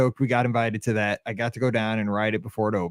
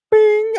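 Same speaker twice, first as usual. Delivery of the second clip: very high, bright bing like a scanner; high electronic bing; imitated beep; 8 dB louder than usual. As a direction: squeak it like a machine beep.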